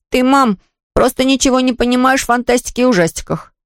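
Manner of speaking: put-on high-pitched boy's protest to mother; son arguing. Сын спорит с мамой. (мальчик спорит, высоким голосом)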